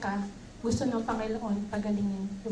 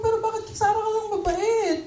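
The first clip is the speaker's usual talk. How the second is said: higher, louder hurt plea with a laugh breaking through; hurt pleading Hagar; 9 semitones higher, 5 dB louder than usual. Acted. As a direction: play the wronged servant asking why, half laughing. Voicing Hagar's hurt complaint. (Hagar na nagtatampo, mas mataas at malakas ang boses)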